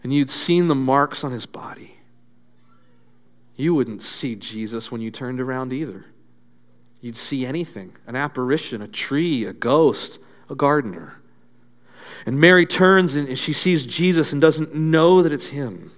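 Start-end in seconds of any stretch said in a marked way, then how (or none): 3.7-11.2 hushed. Real, not acted. Sermon drops to soft list.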